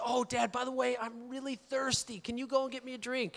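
sweetly wheedling child, lightly acted; three-year-old daughter. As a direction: imitate a small child asking Dad a favor. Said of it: "And she says".

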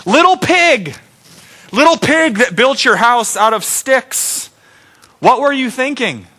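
preacher scolding the third little pig; mock scolding. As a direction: wag a finger at the silly pig.

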